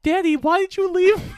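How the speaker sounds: put-on high whiny kid voice; joking as the lost girl to her father; mock little girl. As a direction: play the abandoned child, pleading. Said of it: "I kept going".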